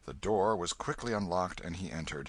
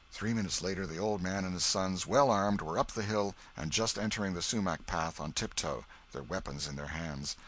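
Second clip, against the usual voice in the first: plain reading voice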